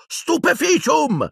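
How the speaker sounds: high, stretched battle cry; shouted spell. A narrator's reading. Harry aiming at Piton's back, shouting. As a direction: fling the spell out in a long high yell. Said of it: "mirò alla schiena di Piton e gridò".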